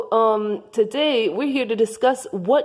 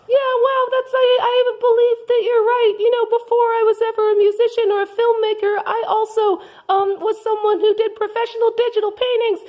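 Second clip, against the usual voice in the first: high Martha voice; 11 semitones higher, faster than usual. Acted. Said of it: "I am Martha".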